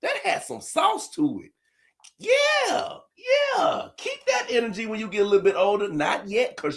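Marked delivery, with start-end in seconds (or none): 0.0-1.5 impressed praise. Retold or imitated. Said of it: "I said". 2.2-5.4 high shouted yeah; high hype. Real, not acted. Dad hyping his daughter's swear, high and shouted. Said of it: "hyping the kid".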